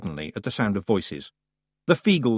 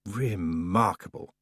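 haughty goddess voice; 4 semitones lower, 5 dB quieter, slower than usual. Acted. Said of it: "she said".

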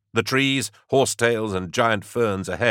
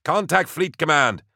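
high yell over the radio; yelling over comm; 9 semitones higher than usual. A narrator's reading. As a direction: shout the order down the bead. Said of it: "he yelled to Raglan".

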